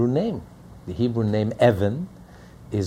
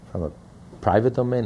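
normal talking voice

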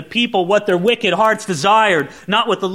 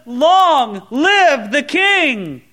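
crowd shout; 8 semitones higher, 5 dB louder, much slower than usual. Retold or imitated. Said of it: "all the people shouted and said".

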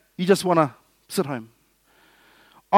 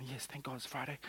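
much softer, relieved calm; 6 semitones lower, 25 dB quieter than usual. Retold or imitated. End-of-week relief to the congregation, soft and calm. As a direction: sigh it out gently.

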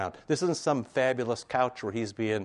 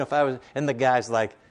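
normal talking voice

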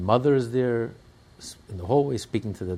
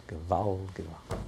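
soft, low, sinking lament; hushed cry; 3 semitones lower, 7 dB quieter than usual. Retold or imitated. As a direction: say it quietly, almost to yourself. Quiet reading of the written cry.

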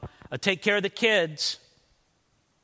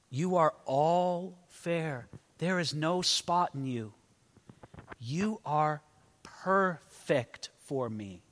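tender; 4 dB quieter, slower than usual. Acted. Husband speaks tenderly to wife.

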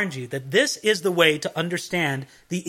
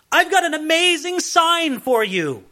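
higher, grand announcement of a sign; lightly voiced herald proclaiming a wondrous sign; prophet announcing a sign; 9 semitones higher than usual.

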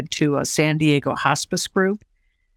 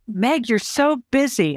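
lightly mimicked, higher-pitched remark; gushing acquaintance imitated; 7 semitones higher than usual. Others' remark acted in retelling.

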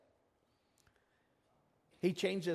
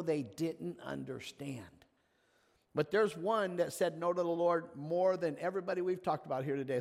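ordinary talk, nothing marked

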